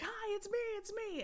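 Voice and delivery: very high, loud puppy voice; eager puppy. Acted. Owner voices puppy charging over to play, high and excited. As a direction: squeal it like a puppy wanting to play. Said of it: "charging at me, like, wanting to play".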